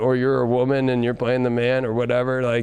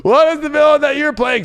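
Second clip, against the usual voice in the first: much higher, louder dramatic question; excited; 11 semitones higher, 6 dB louder than usual. Real, not acted. Reader asks Sagittarius viewers dramatically, high and loud. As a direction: throw the question out with a big lift.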